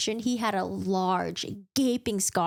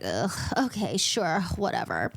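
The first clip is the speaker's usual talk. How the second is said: lower, slowed, put-upon grumble; annoyed haughty Lucien; 5 semitones lower than usual. Retold or imitated. Lucien grudgingly agreeing to Farrah joining the ride, annoyed. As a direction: sigh it out like he can't be bothered. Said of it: "this tone of ... annoyance, a haughty attitude".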